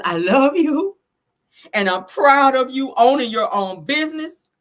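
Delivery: retelling a mother's tearful words, voice high and straining; voice cracking. Retold or imitated. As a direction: let the voice crack as you quote her. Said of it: "Her voice starts to crack".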